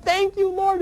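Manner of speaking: very high, thrilled cry of thanks; thanking God on seeing the spot open; ecstatic thanks. Retold or imitated. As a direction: burst out in joyful disbelief. Finds the parking spot open.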